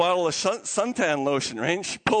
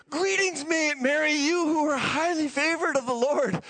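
higher, excited angelic greeting; brimming with excitement; 6 semitones higher than usual. Acted. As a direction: greet her as if bursting with news. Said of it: "He's just brimming with excitement".

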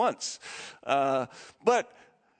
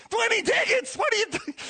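outraged scolding. Retold or imitated. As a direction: shriek at him in disbelief. Mom scolding the dad.

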